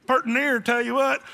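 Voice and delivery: mock country drawl, slow and loud; backwoods Southern drawl. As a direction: play a backwoods good ol' boy. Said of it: "if they're talking like that".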